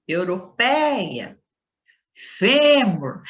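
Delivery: each word slow, second-last syllable stretched and forceful; stress on penultimate syllable. Shown as a demonstration. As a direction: hit the ÇÚ in "Açúcar", the FÊ in "fêmur". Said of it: "a penúltima sílaba é pronunciada". (penúltima sílaba alongada e forte, palavra por palavra)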